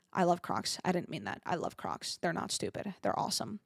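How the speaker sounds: low, soft backpedal; quick little retraction under the voice; apologetic aside. Real, not acted. Taking back an insult to the Crocs, low apologetic aside.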